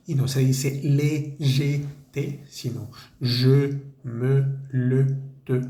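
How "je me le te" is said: je me le te with a rounded closed euh vowel; slow demo of je, me, le, te; closed-mouth e. Shown as a demonstration. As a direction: keep the mouth almost shut on je, me, le, te. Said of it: "así con la boca cerrada".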